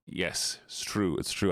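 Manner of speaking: usual speaking voice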